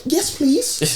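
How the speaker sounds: high-pitched, amused polite reply; retold eager acceptance. Passenger answering the air hostess, eagerly polite. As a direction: act out a delighted little acceptance. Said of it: "I'm like".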